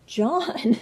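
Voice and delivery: laughing at her own slip; a laugh on the name, then the fix. Real, not acted. Teacher laughing as she restarts the name. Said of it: "I said that wrong".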